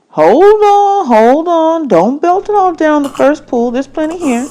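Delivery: put-on gently stopping a gulping kid; the man's voice. Man's voice in audiobook.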